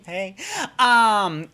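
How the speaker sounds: normal talking voice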